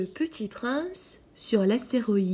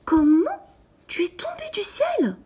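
a loud high exclaim, then whispered; amazed cry; 12 semitones higher, 4 dB louder than usual. A narrator's reading. The little prince cries out to the pilot fallen from the sky, loud then whispered. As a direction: gasp it out in disbelief. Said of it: "Alors il s'écria".

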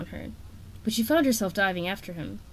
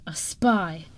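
hissed; 2 semitones lower than usual. A narrator's reading. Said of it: "Queen Coral hissed under her breath".

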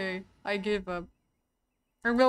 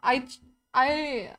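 ordinary delivery

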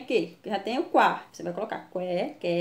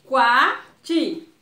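Quati with a loud, drawn-out "kwa"; strong syllable; 3 semitones higher, 9 dB louder than usual. Shown as a demonstration. Teacher showing children the strong sound, Quati loud on "kwa". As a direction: hit the first syllable of Quati hard. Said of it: "som bem forte".

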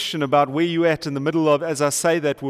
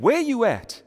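sassy street challenge, higher first; with attitude; 6 semitones higher than usual. Shown as a demonstration. Pastor showing the congregation how to say it, with mild attitude. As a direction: toss it out with a bit of attitude. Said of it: "say with a little bit of attitude".

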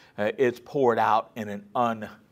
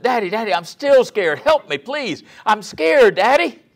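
put-on much higher, louder pleading child; frightened little boy; 12 semitones higher, 9 dB louder than usual. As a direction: play the son begging for help in the storm. Scared boy returns to bedroom.

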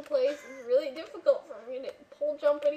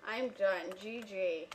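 defeated; 6 semitones lower, 6 dB quieter, slower than usual. Real, not acted. Giving up the game.

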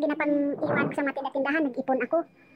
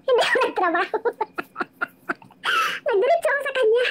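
higher and louder, laughing through the words; laughing confession; 8 semitones higher, 4 dB louder than usual. Real, not acted. Admitting she skipped work for him, laughing. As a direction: giggle out the admission.

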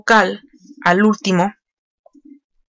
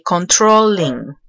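"controlling" drawn out very slowly by syllables; slow syllable-by-syllable demo; 2 semitones lower, much slower than usual. Shown as a demonstration. Teacher showing the doubled L, "controlling" said very slowly. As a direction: stretch "controlling" out for learners. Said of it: "vamos a duplicar la L".

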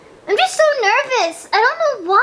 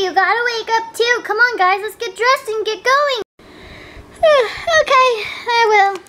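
ordinary delivery

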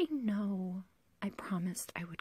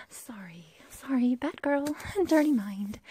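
flirty giggle; 3 semitones higher, 7 dB louder than usual. Acted. Laughs, scolds own dirty mind.

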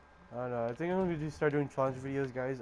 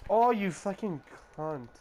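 higher, louder and slow, a furious curse; angry outburst; 7 semitones higher, 6 dB louder, slower than usual. Real, not acted. Gamer raging at an opponent in the game, real angry swear. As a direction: spit it out at the screen in real frustration.

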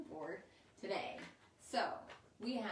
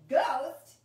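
"Ghost" shouted out much louder; loud excited repeat; 4 semitones higher, 14 dB louder than usual. Shown as a demonstration. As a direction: call "Ghost" back at full volume, eager. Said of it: "And you're gonna say".

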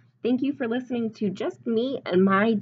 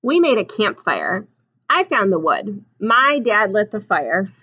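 storybook reading; 7 dB louder, slower than usual. A narrator's reading. Reads picture book aloud.

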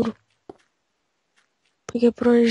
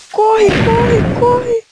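high, panicked cry to run; panicked yelling; 11 semitones higher, much slower than usual. Real, not acted. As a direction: shriek at them to flee. Fugindo de monstros no jogo. (grito de pânico)

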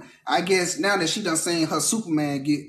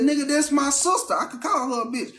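indignant brother; 8 semitones higher than usual. Retold or imitated. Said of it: "I said".

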